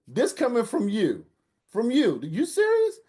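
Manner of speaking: high, loud indignant challenge; incredulous. Retold or imitated. Son calling out his mother's hypocrisy, high and loud. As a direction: throw it back at her.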